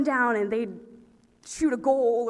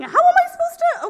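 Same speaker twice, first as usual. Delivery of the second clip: exasperated squeak; 10 dB louder, faster than usual. Retold or imitated. Confused exasperation.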